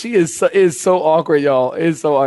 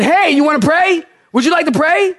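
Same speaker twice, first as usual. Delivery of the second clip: higher, raised voice calling out; calling across room; 11 semitones higher than usual. Retold or imitated. Pastor calls out to the nurse again, higher and raised. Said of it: "Like, way across the room".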